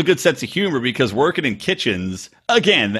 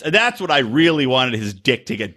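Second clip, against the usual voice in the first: usual speaking voice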